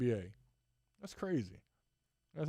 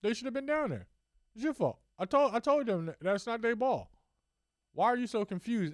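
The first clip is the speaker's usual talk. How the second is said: higher mock rant at the ref; imitating Cuban; 8 semitones higher than usual. Owner ranting at referee, high mock outrage. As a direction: play an outraged owner chewing out refs. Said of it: "It's like".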